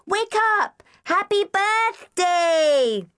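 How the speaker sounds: put-on high, loud child's shout, slowing at the end; little girl yelling. A narrator's reading. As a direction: bellow it like an excited small child. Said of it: "yelled Debbie".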